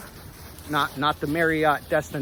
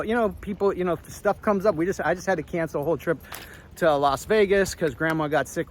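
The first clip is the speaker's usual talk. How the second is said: ordinary delivery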